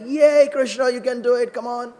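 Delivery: high, loud cheer from the crowd; acted excited cheering. Cowherd boys cheer Krishna on.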